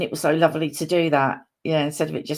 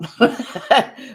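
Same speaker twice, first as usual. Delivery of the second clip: higher, brief self-mocking laugh; real burst of giggling; 5 semitones higher, 4 dB louder than usual. Real, not acted. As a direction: laugh at your own muddle. Laughs at own confusion over times.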